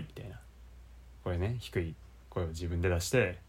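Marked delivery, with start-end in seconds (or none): 1.2-3.4 a deep, put-on low voice; showing off a low, manly voice; low voice demo. Shown as a demonstration. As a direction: drop into your deepest voice.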